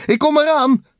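very high, loud answering shout; calling back. A narrator's reading. Takel answering Bliksem's call, high eager shout. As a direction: yell back in a high character voice.

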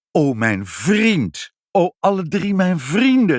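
gushing, stretched-out exclamations swooping high; effusive, passionate thanks; delighted and grateful. A narrator's reading. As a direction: greet them with overflowing joy. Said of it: "toen verrukt en heel dankbaar".

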